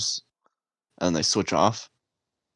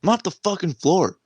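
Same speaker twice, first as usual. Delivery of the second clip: raised-pitch mock order; barking drill sergeant; 11 semitones higher, 3 dB louder than usual. Drill sergeant ordering recruit to mop, high-pitched mock command. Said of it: "And she's like".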